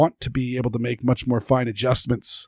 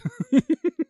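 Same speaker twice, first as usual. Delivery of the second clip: high laugh. Real, not acted. Laughs at pirate joke.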